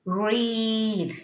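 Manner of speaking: "read" with the ee held long; prolonged E. Shown as a demonstration. Teacher giving an example word, prolonged E. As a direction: draw out the vowel in "read". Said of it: "you have to prolong it".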